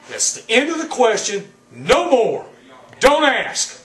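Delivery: angry. Real, not acted. Wrestler refusing interviewer's questions.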